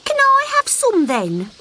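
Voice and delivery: high wheedling little frog; pleading little frog. Acted. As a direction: beg your mum sweetly. Frog asking.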